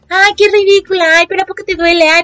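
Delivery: high, scornful mock of a man belittling period pain; mocking dismissive man. Mocking a guy who says period pain is fake. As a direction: sneer it out in a put-on high voice. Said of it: "de decir como".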